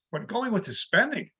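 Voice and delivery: ordinary delivery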